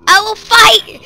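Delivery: loud high defiant cry through a laugh; rally yourself half laughing; mock battle cry. Real, not acted. Gamer fighting back mobs, laughing battle cry. Defiant while being attacked.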